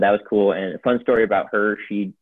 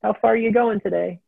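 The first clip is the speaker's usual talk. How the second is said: higher, bright sing-song call to a hiking buddy; calling out to Brian in a much higher, eager voice; chirpy call-out; 14 semitones higher than usual. Retold or imitated. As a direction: hail him cheerfully across the trail. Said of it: "I'd be like".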